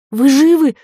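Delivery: high, loud anxious question; alarmed call. A narrator's reading. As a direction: call out urgently to a blast victim. Calling to woman after the blast. (встревоженный громкий возглас)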